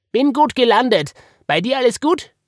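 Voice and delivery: high, loud little animal voice; squeaky, upbeat cartoon character; perky bunny character. A narrator's reading. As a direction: chirp it out to reassure. Woggle reassures Marie after crash.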